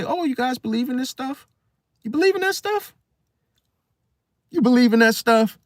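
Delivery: very high scoffing questions; mock incredulity. Skeptics commenting on the channel, high and incredulous. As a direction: push the pitch up in exaggerated disbelief.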